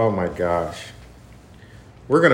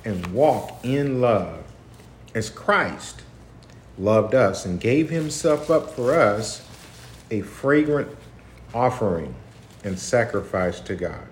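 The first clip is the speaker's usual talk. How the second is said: slow, raised preaching read; scripture reading; 4 semitones higher, 4 dB louder than usual. Acted. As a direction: read the verses out like a sermon. Reads scripture aloud.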